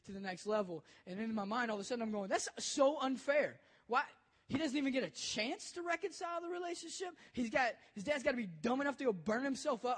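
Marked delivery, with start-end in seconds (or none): 2.3-10.0 retold outburst, higher and louder at first; angry protest. Youth pastor inwardly railing at the father's death, angry. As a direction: protest the unfairness, pitch jumping up. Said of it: "I'm just angry at the scenario".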